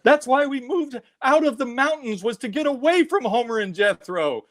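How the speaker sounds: high-pitched mock maternal lament; dismayed mom. Crestfallen mom to her hillbilly-loving child, high mock dismay. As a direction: wail it as a let-down mother, hammed-up.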